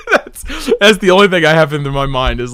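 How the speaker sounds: usual speaking voice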